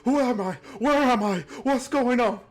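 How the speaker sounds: a freshly dead stranger begs, crying in terror; panicked soul. Acted. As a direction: cry out like a soul dragged into death. Newly dead soul appears panicking.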